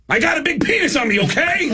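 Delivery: high, hammed-up blurt; mock defiant announcement. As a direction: drummer walking into the dressing room, high mock confession. Drummer confessing to bandmates.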